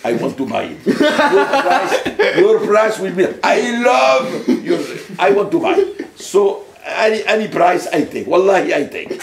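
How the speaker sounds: hammed-up Arabic accent, high and loud; Arabic-accented English. Shown as a demonstration. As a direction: exuberant put-on Arabic accent, building to a shout. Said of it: "je ne parlais que anglais avec l'accent arabe".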